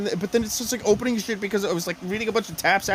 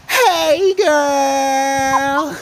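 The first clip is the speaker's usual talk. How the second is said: very high, loud drawn-out squeal; over-the-top, stretched-out hello; gushing prank greeting; 8 semitones higher, 9 dB louder than usual. Retold or imitated. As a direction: greet a stranger like a best girlfriend. Prank greeting to phone scammer.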